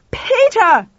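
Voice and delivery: high, loud bellowed name. A narrator's reading. Lauren yelling for Pal in the ruined house, bellowing. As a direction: bellow the name with all dignity gone. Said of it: "Lauren bellowed".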